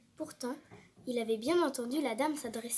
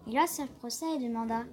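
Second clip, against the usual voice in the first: ordinary delivery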